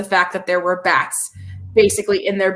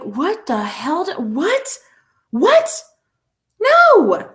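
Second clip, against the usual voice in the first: growing disbelief; 7 semitones higher, much slower than usual. Retold or imitated. Retold shocked reaction to announcer.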